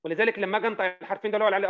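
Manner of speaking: ordinary talk, nothing marked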